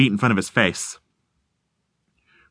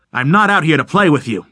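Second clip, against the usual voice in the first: louder, higher tough-guy bluster; blustering threat; 5 semitones higher, 4 dB louder than usual. Acted. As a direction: puff up and threaten. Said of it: "Rickert blustered".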